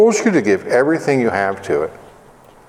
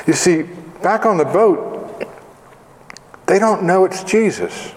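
usual speaking voice

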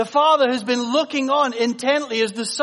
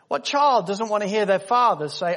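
usual speaking voice

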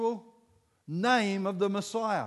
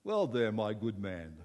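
low, slow posh-English imitation; English gent; 9 semitones lower than usual. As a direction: put on an English gentleman's accent. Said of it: "one of those Englishmen".

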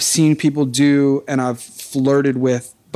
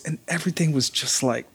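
ordinary talk, nothing marked

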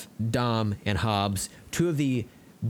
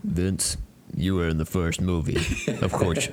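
mock Vin Diesel; 3 semitones lower than usual.

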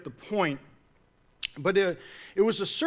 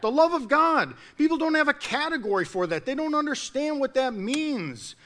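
usual speaking voice

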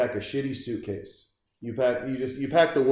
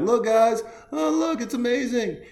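mock cheerful dad; 9 semitones higher than usual. Dad showing his son off to the firemen, in a high fake-cheerful voice. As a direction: fake delight, high and bright.

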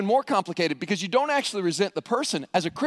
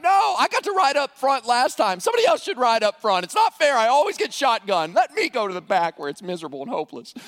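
put-on higher, insistent child's complaint; mock kid protest; 4 semitones higher, 3 dB louder than usual. Kid arguing against riding up front, mock-indignant. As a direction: play a kid objecting to an unfair treat. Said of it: "Your kids are never gonna be like".

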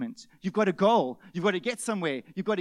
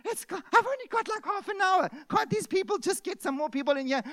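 higher pitched impatient complaining; mock fed-up bank customer; exasperated muttering; 11 semitones higher, 3 dB louder than usual. Frustrated in bank queue.

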